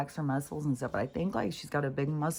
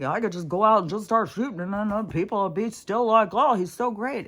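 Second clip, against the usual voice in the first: mock Trump quote; 5 semitones higher, 7 dB louder than usual. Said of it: "what Trump was saying".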